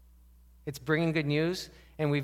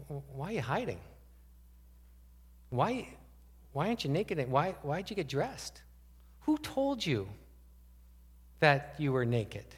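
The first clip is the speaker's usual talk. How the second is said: gentle questioning; 4 dB quieter than usual. Retold or imitated. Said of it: "He asked questions".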